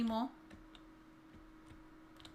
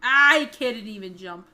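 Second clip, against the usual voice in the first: slow, loud exasperated complaint; frustrated loud; 12 dB louder than usual. Real, not acted. Failing in game.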